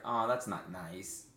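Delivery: slow, understated retold protest; drawn-out, low-key reproach; mild reproach. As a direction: answer an insult with a slow, gentle objection. His mild reply to hooker.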